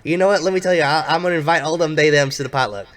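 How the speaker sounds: put-on Southern accent, big and friendly. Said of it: "very thick Southern accent".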